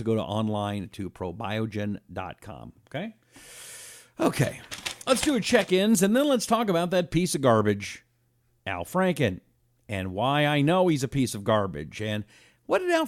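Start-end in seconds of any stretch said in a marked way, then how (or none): none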